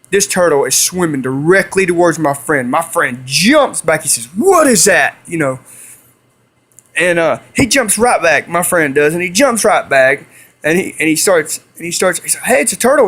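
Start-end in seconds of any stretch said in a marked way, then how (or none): 4.3-5.2 higher-pitched startled question; startled exclamation. Retold or imitated. Friend jumps back from the swimming turtle, startled. 12.4-13.1 high-pitched excited call-out; excited discovery. Retold or imitated. Friend tells his buddy what he found, excited.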